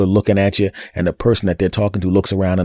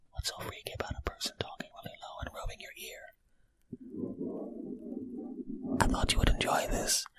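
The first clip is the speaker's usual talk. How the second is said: ASMR whisper demo; 17 dB quieter than usual. Shown as a demonstration. Podcast host showing his partner ASMR, whispering. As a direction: whisper right into the mic like an ASMR video. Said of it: "talking low into my ear".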